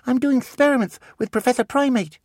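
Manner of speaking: put-on high small-boy voice; a young boy asking eagerly; child voice. Miles asks Artemis, in a high child voice.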